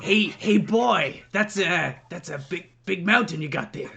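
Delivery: a light gruff Clint Eastwood take; lightly voiced gritty cowboy, barely changed; accidental Clint Eastwood. Second try at the line, lands closer to Clint Eastwood. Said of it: "more Clint Eastwood".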